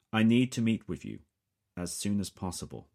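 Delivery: decisive British voice, taking charge; British accent. A narrator's reading. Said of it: "The voice has a most definite British accent".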